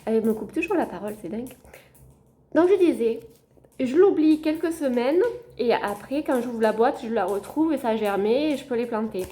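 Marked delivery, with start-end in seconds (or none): none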